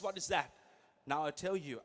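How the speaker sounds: ordinary delivery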